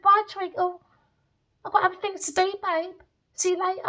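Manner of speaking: high, sing-song dismissive goodbye; breezy brush-off. Retold or imitated. Saying bye to Treacle, high and mocking. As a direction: wave him off sweetly.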